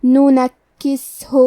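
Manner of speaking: ننکسہ very slow, the س breathy and weak, the ک doubled; hams on seen. Shown as a demonstration. Teacher showing hams to students, very slow breathy seen. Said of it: "تو ہم یہ کلمہ کو ایسے پڑھیں گے".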